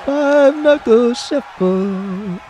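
high, slow and loud; hype move call. Real, not acted. Gamer hyping his wrestler's signature move, drawn-out call. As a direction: stretch the move name out like an announcer.

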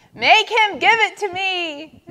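high, loud demand; screamed. A narrator's reading. Turtle appealing to Yak and Zebra, screaming. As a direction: scream it like a child in a tantrum. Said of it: "screamed Turtle".

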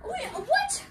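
very high, startled question; baffled. Real, not acted. Commentator stunned that both cars are out, baffled. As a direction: squeak it out in disbelief.

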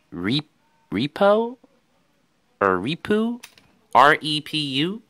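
questioning, pitched high with rising ends; tentative guesses, each lifting like a question; unsure of pronunciation. Real, not acted. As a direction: sound out an unfamiliar name, unsure. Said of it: "I don't know if that's how you pronounce it".

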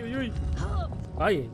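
usual speaking voice

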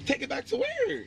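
high-pitched disbelief; high incredulous question. Real, not acted. Argument over returning.

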